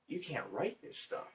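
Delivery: acted hushed wonder; quiet amazement. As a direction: marvel under your voice. Acting out amazed onlooker.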